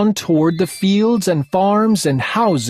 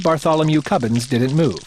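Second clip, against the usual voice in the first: ordinary delivery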